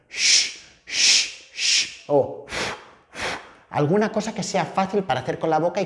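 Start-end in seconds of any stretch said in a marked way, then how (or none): 0.1-2.0 long repeated "shh" blown out; voiceless hiss of shushing; shushing sound. Shown as a demonstration. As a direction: shush rhythmically for a child to copy. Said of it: "Y entonces hago como".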